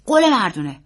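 put-on insistent young nephew; earnest boy. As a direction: ask for a solemn promise as a child. Boy demands promise, uncle echoes.

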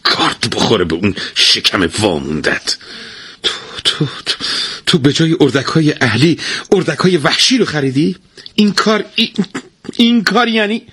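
stammering fury rising high; angry. Acted. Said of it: "با عصبانیت".

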